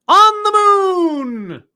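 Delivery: high, loud, drawn-out proclamation; hammed-up announcement. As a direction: stretch it out like a grand show opening. Show intro, grand announcement.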